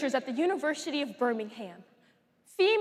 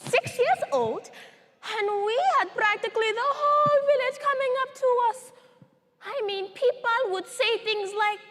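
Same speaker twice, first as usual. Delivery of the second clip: high, animated storytelling; survivor reliving childhood excitement; 8 semitones higher than usual. Acted. Survivor recalling the whole village's visit, excited and high. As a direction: lift the voice high with a child's wonder.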